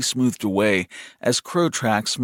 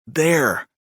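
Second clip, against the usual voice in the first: higher, definite falling statement; insistent little brother; 5 semitones higher than usual. A narrator's reading. Brother answering his puzzled brother, insistent. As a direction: state it firmly as a refrain.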